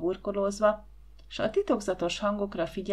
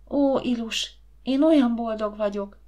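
raised, rapturous sigh of joy; happy murmuring; 2 semitones higher, 5 dB louder than usual. A narrator's reading. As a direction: confide overwhelming happiness to a friend. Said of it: "rebegte Cilike".

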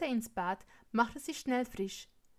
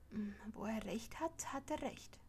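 soft, whispered musing; hushed, conceding inner voice; thought to oneself; 9 dB quieter than usual. A narrator's reading. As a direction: murmur it as a private thought. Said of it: "dachten sie".

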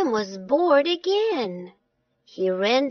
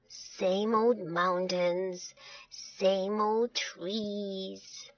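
slow, soft, weary monotony; very slow and listless; bored; 3 semitones lower, 7 dB quieter, much slower than usual. Acted. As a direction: drag it out, bored stiff. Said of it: "he was so bored".

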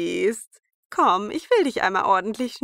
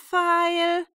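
high loud peddler cry; calling out; 10 semitones higher, 5 dB louder than usual. A narrator's reading. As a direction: call wares at the door.

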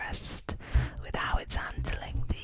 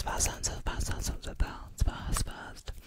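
fast aggressive asmr. Acted. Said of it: "fast aggressive asmr".

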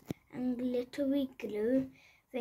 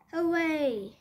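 cheer; 5 dB louder than usual. Acted. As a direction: cheer for the trip to Granny. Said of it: "everyone cheered".

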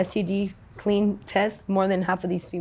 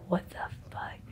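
voiceless whispered what the fuck; shocked whispered swear; whispered disbelief; 13 dB quieter than usual. Retold or imitated. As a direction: breathe it in stunned disbelief. Reacting to racist insult.

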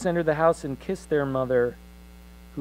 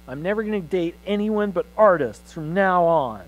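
put-on higher and louder, a boy's bold declaration; defiant boy; 6 semitones higher, 7 dB louder than usual. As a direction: announce it like a headstrong son. Boy declares manifesto to mother.

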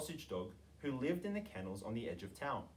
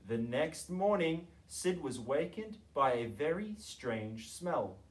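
ordinary delivery, nothing marked

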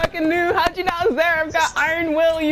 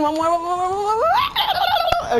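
laughing panic; 10 semitones higher than usual. Real, not acted. Finishing task under pressure.

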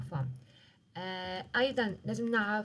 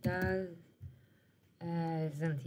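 doesn't said again through a real laugh, softer and lower; 4 semitones lower, 4 dB quieter than usual. Real, not acted. Teacher correcting herself after a slip, laughing. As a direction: laugh it out as you fix the slip. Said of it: "سوري".

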